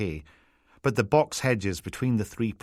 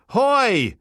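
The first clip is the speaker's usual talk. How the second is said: sharp, high Peterhead yell, much louder; hard Peterhead accent; 8 dB louder than usual. Acted. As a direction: bark it in broad Peterhead Scots. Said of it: "A hard Peterhead accent blared out".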